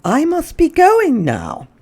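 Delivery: a visitor announcing she must go; Mrs Hipple leaving. Acted. Mrs Hipple tells Mrs Prim she is leaving, high and prim. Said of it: "Lyle heard Missus Hipple say".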